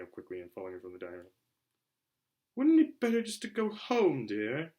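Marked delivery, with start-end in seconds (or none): 2.6-4.7 timid, much higher little plea; timid suggestion. A narrator's reading. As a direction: coax him meekly, pitched high up. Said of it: "Aunt Petunia suggested timidly".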